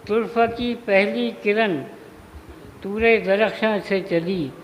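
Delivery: measured mushaira delivery, phrase by phrase; Urdu poetry recitation. Acted. Poet honouring Turfa before the gathering, measured recitation. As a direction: declaim each half-line and let it hang.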